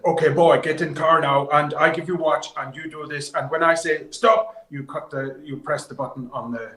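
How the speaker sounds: loud, high Finnish co-driver barking orders; Finnish accent. Retold or imitated. Rally driver ordering the young co-driver, in a loud Finnish accent. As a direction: bark instructions in a thick Finnish accent. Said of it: "in his best Finnish accent".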